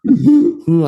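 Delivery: high, loud winded "ooh"; mock wheezing. Passenger offered water, mock wheezing. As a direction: wheeze it out, still catching your breath. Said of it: "I'm still wheezing and hacking".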